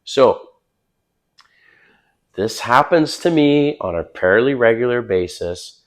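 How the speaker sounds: ordinary delivery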